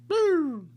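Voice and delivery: sudden voiceless boo; whispered boo. Retold or imitated. Cops rolling up on the kids, a voiceless mock boo. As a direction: mark the cops' arrival with a breathy boo.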